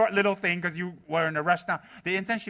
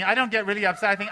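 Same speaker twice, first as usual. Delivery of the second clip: usual speaking voice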